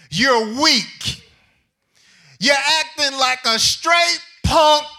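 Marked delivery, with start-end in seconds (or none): none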